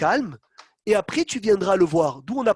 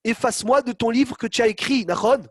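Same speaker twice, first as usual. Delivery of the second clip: normal talking voice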